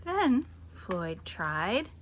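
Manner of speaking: ordinary delivery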